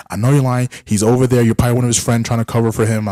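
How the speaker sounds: mild mock fury of a jealous caller; mock angry accusation. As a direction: accuse him hotly like a jealous ex. Angry accusing woman quoted.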